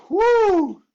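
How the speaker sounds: loud high whoop of relief; high relieved whoop. Real, not acted. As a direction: let out the held effort. Release after holding the plank.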